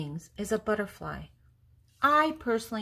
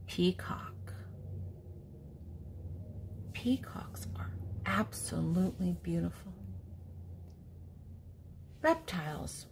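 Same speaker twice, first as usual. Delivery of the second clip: whisper; 6 dB quieter than usual. Acted. Whispered answer and aside.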